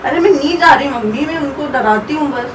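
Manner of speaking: calm her loudly over the crying; insistent reassurance. Real, not acted. Comforting crying mother, louder.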